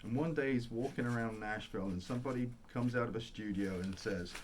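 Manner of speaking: ordinary talk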